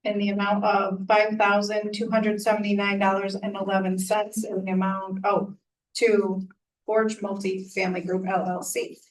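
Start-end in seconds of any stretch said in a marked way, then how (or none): none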